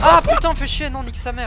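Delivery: loud frustrated cursing; angry outburst. Real, not acted. Teammate just died in game.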